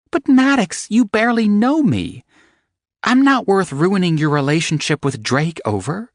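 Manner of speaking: high startled woman's voice; shocked young woman. Acted. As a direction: protest in disbelief as the heroine. Evangeline protests in shock.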